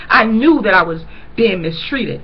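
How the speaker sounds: usual speaking voice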